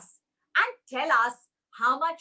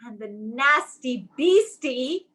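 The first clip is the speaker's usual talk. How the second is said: plain reading voice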